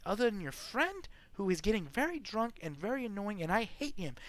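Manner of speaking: put-on high, chatty complaint about the drunk friend; gossiping wife. Wife gossiping to the guest about his friend, high chatty voice. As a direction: ramble on like a petty noblewoman. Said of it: "she looks at you and goes".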